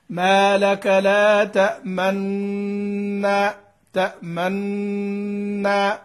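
slow recitation showing ishmam on تأمنا, lips rounding at the start of the nun. Shown as a demonstration. Teacher slowly demonstrating ishmam in تأمنا to students. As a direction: stretch تأمنا slowly, lips pointing to a damma as the nun begins. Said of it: "نشير عند بداية النطق بالنون".

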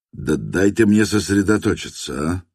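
ordinary delivery, nothing marked

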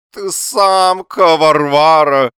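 high, loud, slow moaning insult; drawn-out whine. A narrator's reading. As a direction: whine it in long stretched syllables. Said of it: "тягуче заныл он".

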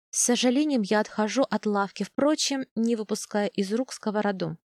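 usual speaking voice

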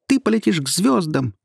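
high, fervent exclamation; cry out. Acted. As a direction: farewell cried out with all her fire. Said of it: "вскрикнула про себя звёздочка".

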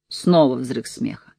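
usual speaking voice

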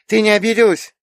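put-on small girl checking she hasn't hurt someone; anxious little girl. A narrator's reading. Little girl asks aunt if she is hurt, anxious. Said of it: "Девочка тревожно глянула".

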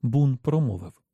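usual speaking voice

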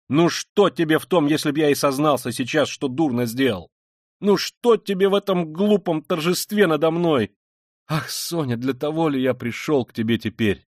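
shouting in despair; desperate outcry. A narrator's reading. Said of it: "вскричал он ... с каким-то даже отчаянием".

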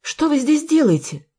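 high, astonished demand; bewildered question pitched well up; amazed. A narrator's reading. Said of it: "изумилась Марина". (изумлённо, высоким голосом)

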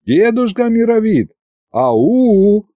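high-pitched hailing shout; loud call. A narrator's reading. Narrator hails the old sorcerer who ignores him, calling loudly. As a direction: call out high to get his attention. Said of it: "а потом громко уже обратился к колдуну".

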